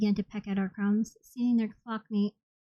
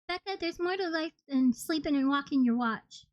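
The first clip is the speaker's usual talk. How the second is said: put-on higher Blue Harbor accent, lilting; 7 semitones higher than usual. A narrator's reading. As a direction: give Verini her Blue Harbor accent. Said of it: "still has this accent to show for it".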